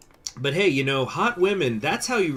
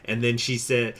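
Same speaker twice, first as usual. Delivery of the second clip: normal talking voice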